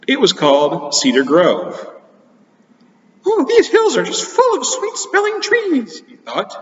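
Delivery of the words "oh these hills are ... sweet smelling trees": high squeaky cartoon mouse; Mickey Mouse voice. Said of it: "I can't really do a very good Mickey Mouse".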